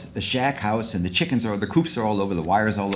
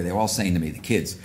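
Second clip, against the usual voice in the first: usual speaking voice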